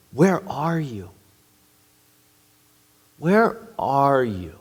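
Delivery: slow, searching call; God calling gently. Acted. Preacher voicing God calling the hiding pair, slow and searching. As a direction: call out slowly to someone hiding.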